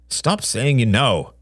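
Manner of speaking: slow, clipped rebuke; a touch of an irritated father; snapped. A narrator's reading. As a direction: bite off each word. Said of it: "snapped John".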